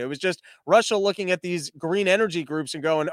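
normal talking voice

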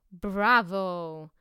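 loud cheering Bravo; exclaiming bravo. A narrator's reading. Stranger applauding Berlioz, loud Bravo. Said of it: "exclaimed the stranger".

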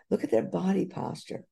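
ordinary talk

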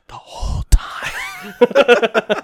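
very slow, high, breaking into laughter; gleeful retold answer. Boyfriend admits to his secret dance, drawn out and laughing. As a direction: stretch out each word, laughing.

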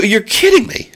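high, surprised "you're kidding me"; stunned disbelief. Retold or imitated. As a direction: react like you can't believe it. His stunned reply to the chef.

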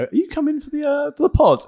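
high, mock-eager question; a lightly voiced eager host; imitating eager asker. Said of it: "message him on Monday and go".

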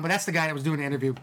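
usual speaking voice

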